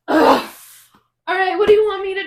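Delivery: mock the annoyed husband dragged out of sleep; groggy husband.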